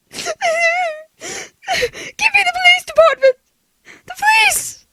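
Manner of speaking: high, sobbing hysteria; terrified. Acted. Woman hearing killer climb the stairs, terrified. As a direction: build from dread to tearful screaming panic.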